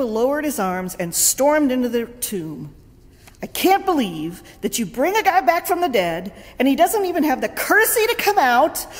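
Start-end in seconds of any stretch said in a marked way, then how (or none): 3.4-8.9 high, fast indignant grumble; grumbling rant. Retold or imitated. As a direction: vent while storming in. Jesus grumbling storming in.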